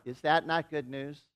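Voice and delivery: ordinary talk, nothing marked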